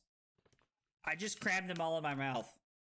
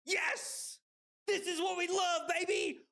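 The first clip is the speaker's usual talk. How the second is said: excited cheer; 13 semitones higher than usual. Real, not acted. Celebrating back-to-back wins.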